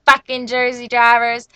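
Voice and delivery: loud, retold road-rage grumble; loud rant trailing into mocking nonsense syllables; mom's mock ranting. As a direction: imitate mom griping at other drivers. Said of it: "she be like".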